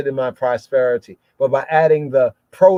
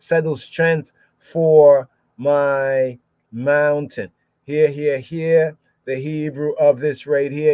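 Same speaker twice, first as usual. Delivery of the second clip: ordinary talk, nothing marked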